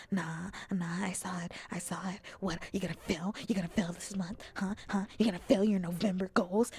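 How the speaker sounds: loud, fast playful taunting; mock confrontation. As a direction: needle them like a fake tough guy.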